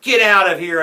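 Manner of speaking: loud, high yell; heckler's shout. A narrator's reading. Another heckler goes at the player, shouting. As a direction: shout to chase someone away. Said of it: "another heckler shouted".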